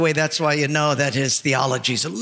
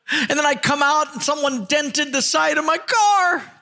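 high-pitched, hammed-up tearful rant; comic weeping city slicker; mock crying; 5 semitones higher than usual. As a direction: blubber through a list of woes, sobbing between phrases. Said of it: "that city slicker starts crying".

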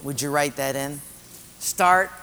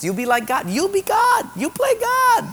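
climbing higher with each promise; tempting devil; 4 dB louder than usual. Acted. Devil urging Eve to play God, rising excitedly. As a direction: build to a high, goading pitch. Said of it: "what the devil was saying".

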